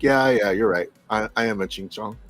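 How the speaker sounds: low, deadpan retold concession; lightly acted sheepish agreement; mock resignation. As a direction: give in drily, voice dropping and softening at the end. Retelling his resigned reply to wife.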